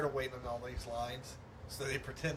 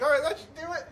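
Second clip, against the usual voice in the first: mock Frank voice; 12 semitones higher, 8 dB louder, faster than usual.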